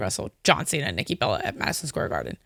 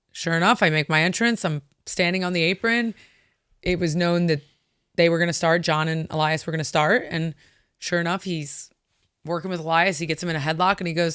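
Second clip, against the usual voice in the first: ordinary talk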